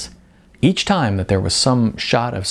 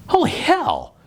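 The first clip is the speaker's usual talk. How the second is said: a high, startled exclamation; exclaiming. A narrator's reading. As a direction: blurt it out in shock at the shelf. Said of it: "he exclaimed loudly".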